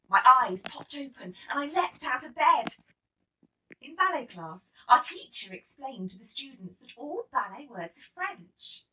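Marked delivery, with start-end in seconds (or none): none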